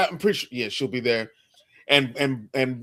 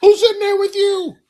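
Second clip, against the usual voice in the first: higher, louder put-on voice for the dog; playful pet voice; 9 dB louder than usual. Host voices the dog outside the door in a high, loud put-on voice. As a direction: squeak it out like a nosy pet. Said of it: "Duke was outside the door like".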